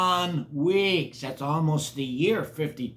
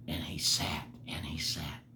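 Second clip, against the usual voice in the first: hushed whisper; 10 semitones lower, 10 dB quieter than usual. A narrator's reading. Faithful Horton waiting.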